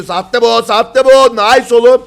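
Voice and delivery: high, loud, punched repeats; excited cheering. Real, not acted. Caster cheers the player on mid-fight, excited shouting. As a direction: yell it out in delight.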